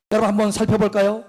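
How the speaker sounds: normal talking voice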